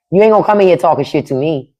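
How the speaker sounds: usual speaking voice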